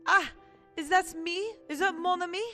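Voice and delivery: high, bright surprised character greeting; lightly voiced surprised character. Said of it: "make voices for every single character".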